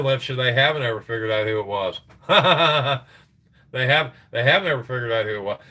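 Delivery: usual speaking voice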